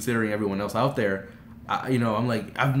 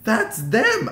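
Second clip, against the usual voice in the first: high, louder starstruck cry; freaking out; 11 semitones higher, 5 dB louder than usual. Retold or imitated. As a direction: blurt it high, like spotting your idols. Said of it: "I was freaking out".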